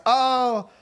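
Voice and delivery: high, hammed-up moaning through laughter; mock wailing dad.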